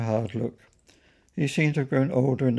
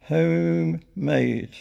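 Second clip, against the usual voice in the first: drawn out very slowly and louder; stretched word, dictated to a keyboard; typing it out; 5 semitones higher, 4 dB louder, much slower than usual. A narrator's reading. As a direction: say it slowly as you type it. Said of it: "typing in the words".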